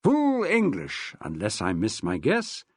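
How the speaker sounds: a hint of a savouring dad, slow and grand; dad sniffing the air. Father teasing his son about breakfast, savouring. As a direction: relish the smell, then drop low. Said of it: "sniffed extravagantly".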